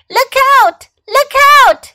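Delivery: high, loud warning call; crying out. A narrator's reading. A voice warning Fox of danger, crying out. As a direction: shout an urgent warning. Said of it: "cried a voice".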